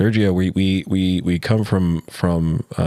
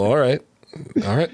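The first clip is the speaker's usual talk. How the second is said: imitated nodding greeting; 7 semitones higher than usual. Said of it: "It's just a little head nod".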